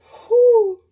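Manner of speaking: breathless exhale after laughing; loud high whew. Real, not acted. Recovering from laughter.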